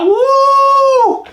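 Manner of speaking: high, loud woo; mock cheer. Secretary cheering the foreman, high woo. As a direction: squeal like an eager secretary.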